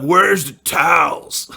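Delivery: loud, slow, high demand; annoyed yell. Retold or imitated. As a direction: bark it out to the whole house. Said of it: "I'm like".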